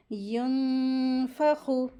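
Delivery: यंफखु with a long nasal hum on the n; ghunna. Shown as a demonstration. Teacher demonstrates ikhfa, long nasal hum in यंफखु. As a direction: hum the hidden n of यंफखु before fa. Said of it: "इस तरीके से".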